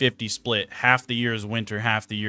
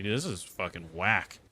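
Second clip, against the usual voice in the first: muttered complaint; 5 semitones lower, 9 dB quieter than usual. Retold or imitated. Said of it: "I was like".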